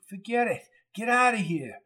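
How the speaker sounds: high, pleading him to leave; a hint of a wounded man's protest; wounded Coco tells Jones to leave him; insistent refusal.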